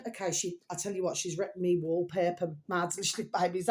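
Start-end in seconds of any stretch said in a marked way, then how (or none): none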